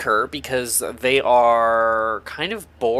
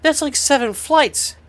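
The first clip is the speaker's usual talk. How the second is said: Nate's voice; 11 semitones higher than usual. Acted. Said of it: "Nate says".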